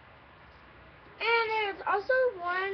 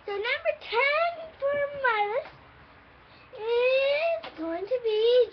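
dramatic announcement; 9 semitones higher, 4 dB louder, slower than usual. Acted. Announces with drum roll.

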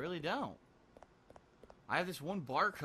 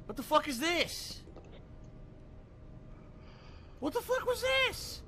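shocked disbelief; 13 semitones higher, slower than usual. Real, not acted. Shocked at discovery in game.

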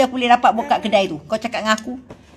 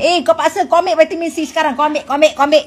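mock bossy nagger; 5 semitones higher, 4 dB louder, faster than usual. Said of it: "Takkan kita nak".

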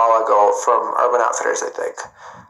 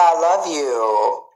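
ordinary talk

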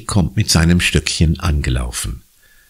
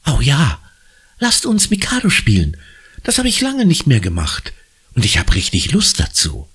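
put-on high, delighted girl; light up at the idea; beaming; 9 semitones higher than usual. Anna delights in playing Mikado, beaming. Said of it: "Anna strahlt".

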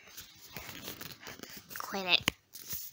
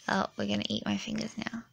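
ordinary talk, nothing marked

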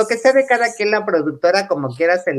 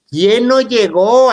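louder, slow "¿quién no llegó?"; alarmed demand; 4 semitones higher, 7 dB louder, slower than usual. Retold or imitated. Planner demanding an answer from the bride, loud and slow. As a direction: press her for the answer, alarmed.

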